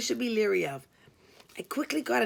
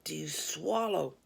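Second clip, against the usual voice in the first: a low, softer whispered caller voice; low creepy whisper; 5 semitones lower, 4 dB quieter, slower than usual. Acted. Prank caller whispering a lewd question down the phone, low and hushed. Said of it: "a low voice asked".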